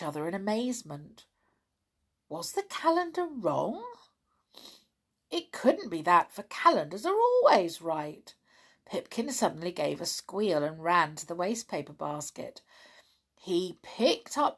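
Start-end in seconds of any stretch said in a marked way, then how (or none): none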